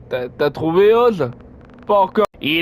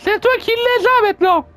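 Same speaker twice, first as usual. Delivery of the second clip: much higher, mocking cartoon voice; high-pitched playful taunt; squeaky taunting voice. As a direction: tease in a squeaky little voice. Taunting the chaser.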